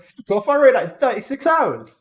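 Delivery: high, loud, slow mock fury; mock outrage. Friend acting out David's outrage at the pay rise, mock fury. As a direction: ham up the indignation.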